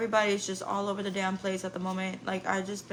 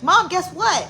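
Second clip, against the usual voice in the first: higher and louder, eager son; bursting with news like a teenage son; excited son; 8 semitones higher, 9 dB louder, slower than usual. Retold or imitated. Son texting mom his big news, higher and excited. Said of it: "and he was like".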